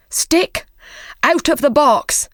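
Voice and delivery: sharp high command to the stick; cried out. Acted. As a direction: call the stick out with force. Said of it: "he cried".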